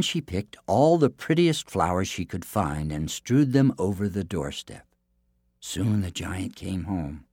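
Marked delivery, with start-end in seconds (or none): none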